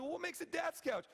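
high-pitched imitated challenge; playful high retort; mock challenging wife. Wife challenging, voiced by husband.

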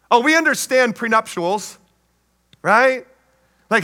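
normal talking voice

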